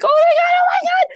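high, loud panicked cry of oh my God; panicked yell. Retold or imitated. Reacting to the dog on screen with a panicked shriek. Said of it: "I'm yelling".

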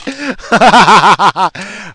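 real laugh breaking out; loud laugh. Real, not acted. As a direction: burst of high, loud laughter. Cracks up at own story.

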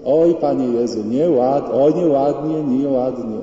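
loud tut-tutting mock reproach; acted scolding. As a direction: scold like an indignant sister, leaning on each word. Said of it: "dwa razy nieźle równęła Pana Jezusa".

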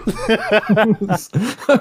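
high burst of laughter. Real, not acted. Laughing at serrated edge.

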